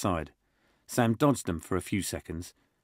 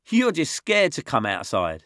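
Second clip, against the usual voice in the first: a hint of a smirk, higher and louder; 8 semitones higher, 6 dB louder than usual. Josh teasing Callum, lightly smirking. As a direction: tease with a grin you can hear. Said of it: "said Josh with a smirk".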